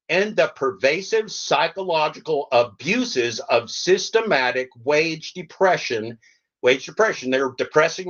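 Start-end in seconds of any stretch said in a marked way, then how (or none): 0.1-6.2 slow deliberate reading. Acted. Reading his manifesto to gig workers, slow and deliberate. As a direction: read each word slowly like a manifesto. Reading demands aloud, slowly.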